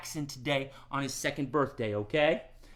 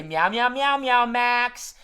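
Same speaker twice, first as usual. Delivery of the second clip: meowing loud; 8 semitones higher, 10 dB louder, slower than usual. Shown as a demonstration. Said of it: "Everyone say".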